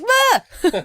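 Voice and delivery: sudden high startled yelp; a quick hammed-up fright cry. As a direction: yelp like you just saw a spider. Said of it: "I was like".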